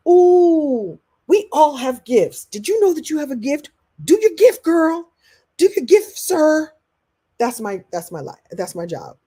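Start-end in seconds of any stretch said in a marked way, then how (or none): 0.1-6.7 high, loud, bubbly cheering; excited cheerleader. Retold or imitated. Speaker cheering listeners on about their gifts, excited and loud. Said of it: "One of my gifts is to go".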